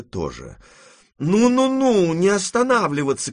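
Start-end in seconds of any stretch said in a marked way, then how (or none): 1.2-3.3 put-on high, loud old-lady shout. A narrator's reading. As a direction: yell impatiently at the stalled party. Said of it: "кричала бабушка". (крик бабушки, высоко и громко)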